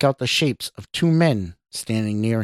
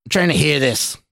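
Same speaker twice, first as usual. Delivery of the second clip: raised, irritated retort; barked; 5 semitones higher, 4 dB louder than usual. A narrator's reading. Stranger eavesdrops on the doctor, barking at Scott. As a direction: snap it with a mild bark. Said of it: "the stranger barked".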